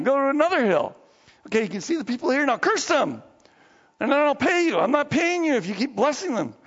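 high-pitched acted ordering; exasperated employer. Balak orders the prophet to curse them, exasperated. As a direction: order and complain in frustration.

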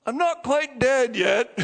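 slow, spooky joke from the casket; playful slow spooky line from a coffin; mock corpse voice. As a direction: rise up slowly like a corpse teasing mourners. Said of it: "I'd be sitting there like this".

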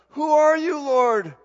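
high, loud startled cry; startled Saul. Retold or imitated. Saul struck by the light, crying out high and loud. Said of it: "Saul's like".

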